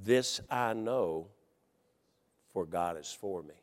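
soft, slow scripture reading; quiet reading. Acted. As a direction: read it low and hushed.

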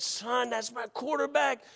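high, drawn-out strained protest, held back in volume; retold coach's scream. Coach pleading over his injured quarterback, mock scream. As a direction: imitate the upset coach, slow and high. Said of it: "he started screaming at me".

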